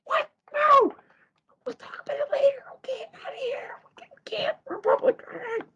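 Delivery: high, squeaky whispering; mock urgent whisper. Oliver and Mabel hushing each other in public, mock whisper. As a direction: imitate a hushed, hurried huddle. Retold hushed protest in public.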